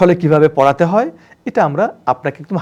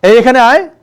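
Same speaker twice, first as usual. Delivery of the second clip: louder, higher brusque summons; curt call to servant; 7 semitones higher, 7 dB louder than usual. Shown as a demonstration. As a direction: bark it at the house boy. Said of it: "person ভেদে আপনার tone change".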